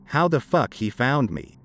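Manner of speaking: usual speaking voice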